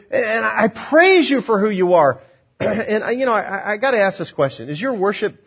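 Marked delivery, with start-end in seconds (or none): 0.1-2.2 high, slow, emphatic praise; joyful, heartfelt praise. Shown as a demonstration. Preacher continuing Simeon's joyful praise, high and exultant. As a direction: praise God jubilantly, stretching the words out. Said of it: "with the way he would do it".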